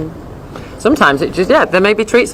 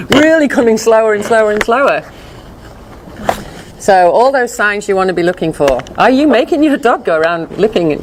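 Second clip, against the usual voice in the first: ordinary talk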